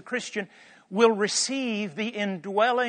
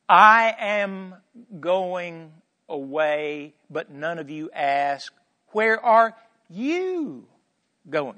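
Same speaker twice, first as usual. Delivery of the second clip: slow, deliberate reading, landing hard on "you"; stress on "you"; 4 dB louder, much slower than usual. Shown as a demonstration. Preacher re-reading Jesus' words with shifted emphasis, stress on "you". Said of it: "emphasis here should be not on the going, but on the you".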